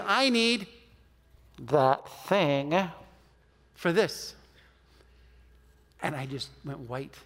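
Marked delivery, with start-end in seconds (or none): 0.1-4.4 slow, broken up before THAT THING and FOR THIS; pointed correction. Retold or imitated. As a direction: leave long gaps around "that thing". Friend spells out the mistaken phrase.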